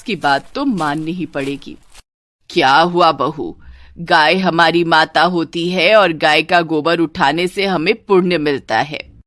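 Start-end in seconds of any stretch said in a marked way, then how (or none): 2.5-9.2 preachy mother-in-law. Acted. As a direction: lecture the bahu on holy duty. (उपदेश देती सास)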